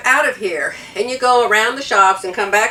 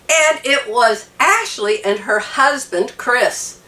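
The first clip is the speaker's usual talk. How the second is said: usual speaking voice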